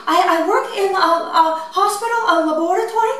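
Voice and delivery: high, sing-song put-on Japanese accent; high-pitched fake Japanese accent, female voice; mock Japanese accent. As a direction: prank job call in a fake Japanese accent. Prank caller voice continues.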